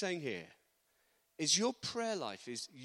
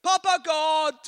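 loud, high imitated prayer cry; imitated fervent shout, far above usual pitch; man crying out in prayer; 11 semitones higher, 12 dB louder, slower than usual. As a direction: call out to God in another man's voice. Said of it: "a man from Sierra Leone just goes".

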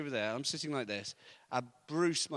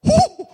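sudden high, loud "ooh"; imitated startled whoop; 20 dB louder than usual. Preacher mimics woman as hearing returns, sudden high whoop. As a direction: burst out with a high mock whoop. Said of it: "this woman goes".

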